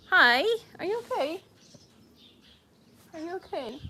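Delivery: high cooing question; pet talk. Real, not acted. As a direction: coo gently to the pet.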